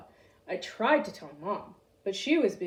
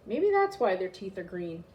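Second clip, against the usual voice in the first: ordinary delivery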